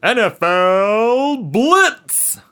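slow, booming game announcer. Pretend. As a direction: hype it like a sports game intro. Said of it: "where did that announcer voice come from?".

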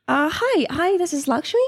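nervous phone manner. Acted. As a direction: introduce yourself uncertainly to a new boss.

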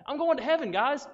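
loud, high cry of joy; excited shout. Real, not acted. Youth preacher fires up the crowd, excited shout. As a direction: call it out to the room.

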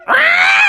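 high startled ghost scream; high-pitched scream of fright; Slimer. Acted. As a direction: shriek in cartoon alarm. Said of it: "Slimer was like".

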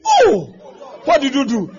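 loud, high mock alarm; big acted shock, then questioning; shocked onlooker. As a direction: exclaim like a scandalized bystander. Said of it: "they come and ask you".

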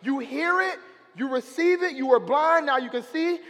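rising sermon fire, near shouting; preaching climax, shouted; fiery preaching. Real, not acted. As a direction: preach it loud and high, building to a shout. Preaching at full force.

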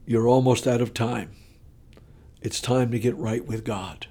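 soft, low, measured warning; hushed inner voice. Retold or imitated. God's voice warning a sleeping drinker, hushed. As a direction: speak like a quiet voice inside the head. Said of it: "I hear this voice in the back of my head".

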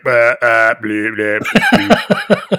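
halting electronic bleeps; malfunctioning robot. Retold or imitated. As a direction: glitch like a short-circuiting robot. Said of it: "Corby's like".

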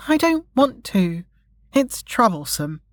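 high reluctant whine, falling away; child's protest. A narrator's reading. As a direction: protest like a child who won't help. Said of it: "Ava protested".

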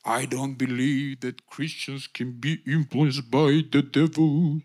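low, slow, ponderous mock preacher voice; another preacher. Speaker imitates a preacher he debated, low and ponderous. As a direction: intone it low and drawn-out, mocking his certainty. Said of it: "I was discussing this with a preacher".

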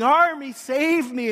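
high, loud, slow cry for rescue; screaming for help. Retold or imitated. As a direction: shout it like a dragged-off kid. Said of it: "I'm screaming".

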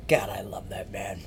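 softly breathed whisper of fondness; hushed adoring aside. Real, not acted. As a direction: whisper it like a confession of love. Fond aside about Mister Irwin.

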